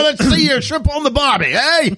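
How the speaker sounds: high, loud hammed-up Aussie; terrible Australian accent. Crocodile Dundee character egging on a woman, mock Australian accent. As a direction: push a cartoonish Australian twang right up high. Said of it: "with my terrible accent".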